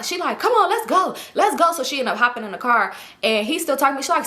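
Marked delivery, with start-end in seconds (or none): 0.4-1.7 impatient friend. Retold or imitated. Friend urging her to leave.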